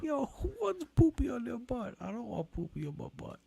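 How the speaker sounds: put-on high silly kid voice; babyish voice. Mocking about poopy butts, high kid voice. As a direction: whine it like a little kid. Mock childish rant about poop.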